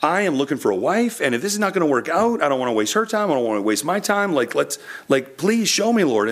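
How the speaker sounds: retold desperate prayer, voice lifted; pleading prayer. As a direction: beg the Lord for a sign, pitch up high. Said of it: "And I was saying".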